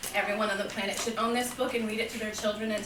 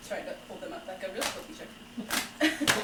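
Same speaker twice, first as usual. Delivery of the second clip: usual speaking voice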